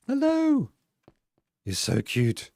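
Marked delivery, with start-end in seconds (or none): 0.1-0.7 squeaky high hello; high cutesy greeting. Real, not acted. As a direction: coo at the fox in a high voice. Greeting cute fox.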